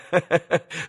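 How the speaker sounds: short acted chuckle; mocking laughter. A narrator's reading. Boys laugh at Alyosha, mocking laughter. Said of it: "засмеялись мальчики".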